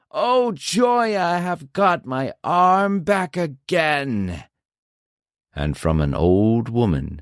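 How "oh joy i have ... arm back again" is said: fearful ogre cry. A narrator's reading. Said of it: "crying with a fearful voice".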